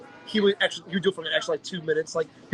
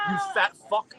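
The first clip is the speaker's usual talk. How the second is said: usual speaking voice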